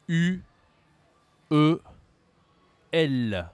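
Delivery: ordinary talk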